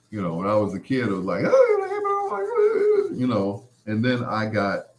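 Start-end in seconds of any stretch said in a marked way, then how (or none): 1.5-3.1 put-on squeaky childish cry. As a kid.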